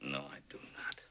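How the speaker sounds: hushed flat denial; whispered. Acted. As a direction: say it under your breath. Firm quiet denial to son.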